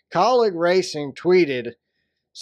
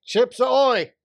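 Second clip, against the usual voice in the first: shouted call; 7 semitones higher, slower than usual. Pretend.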